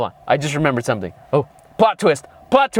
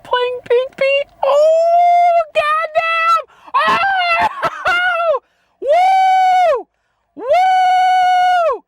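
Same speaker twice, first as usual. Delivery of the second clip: very high falsetto whooping; hammed-up shrieking reveal; 3 dB louder, much slower than usual. Real, not acted. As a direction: scream the payoff in mock amazement. Explodes at the twist reveal.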